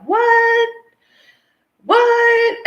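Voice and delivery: squeaky high incredulous what; shocked disbelief. Retold or imitated. Mimicking doubters gasping in high-pitched shock. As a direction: play people hearing something outrageous, squealing it high. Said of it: "a lot of people are like".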